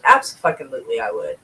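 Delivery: ordinary delivery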